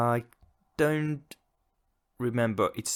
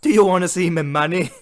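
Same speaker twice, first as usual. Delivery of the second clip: higher and much louder, hammed-up brag in a northern English accent; mock northern English accent; 9 semitones higher, 11 dB louder than usual. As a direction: show off the money in a put-on northern English accent. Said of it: "I've never heard a Nordic person try and, try and do".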